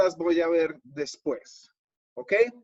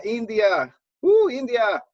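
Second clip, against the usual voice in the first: higher, louder delighted exclamation; pleasantly surprised; 7 semitones higher, 7 dB louder than usual. Real, not acted. Host spotting a viewer from India in the chat, pleasantly surprised.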